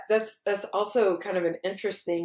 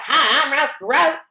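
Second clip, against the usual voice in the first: Ross Perot; 7 semitones higher, 4 dB louder than usual. Retold or imitated. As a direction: put on an exaggerated Texan accent. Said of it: "Anybody who hears that accent's gonna think".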